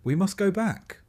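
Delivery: high, loud urgent cry. A narrator's reading. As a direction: cry it out to those around you. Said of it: "a man cries out".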